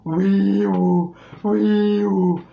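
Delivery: hollow it out with vocal cry; Patrick voice. Shown as a demonstration. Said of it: "a little hollow effect".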